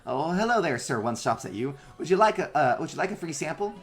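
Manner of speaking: high chirpy robot. Acted. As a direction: offer cheerfully in a tiny robot voice. Robot offering sample.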